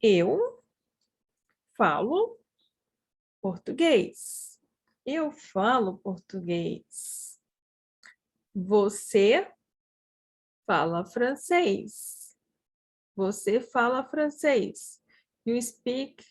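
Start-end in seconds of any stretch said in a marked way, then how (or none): 0.0-4.6 each word alone, long pauses between. Shown as a demonstration. Teacher models a sentence for practice, word by word. 8.5-12.3 long pause after the first word; broken into parts. Shown as a demonstration. Teacher models a sentence for learners, broken into parts.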